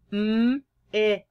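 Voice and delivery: M-X said sound by sound, drawn apart. Shown as a demonstration. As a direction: sound out M-X with a gap after each sound. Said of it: "Can you sound out mix".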